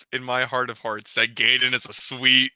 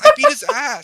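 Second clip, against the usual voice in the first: much higher and louder, a screech of glee; overexcited kid shriek; squealing brag; 8 dB louder than usual. Retold or imitated. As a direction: push the gloat to the top. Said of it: "'cause he's like".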